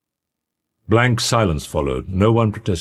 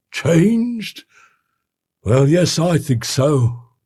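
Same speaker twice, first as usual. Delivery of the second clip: bitter; 11 semitones higher, 3 dB louder, much slower than usual. A narrator's reading. Butler answers with bitter irony.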